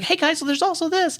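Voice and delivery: playful high mimic of Howard calling after them; mock Howard. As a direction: squeak it out like a left-behind guy. Mimicking Howard chasing friends.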